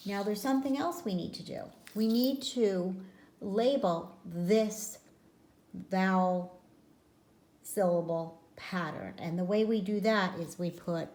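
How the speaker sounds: ordinary talk, nothing marked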